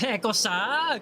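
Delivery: lightly hammed Italian eh cosa; Italian accent. Chatter guessing his ethnicity, in mock Italian. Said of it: "He's like".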